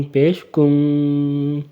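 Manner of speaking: کن with the noon held long in the nose, no waw sound; slow nasal kun. Shown as a demonstration. Quran teacher showing the held noon in kun. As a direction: stretch کن into a long nasal hum. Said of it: "واو کی آواز نہیں نکالنی، نون کی آواز ہی نکالنی ہے".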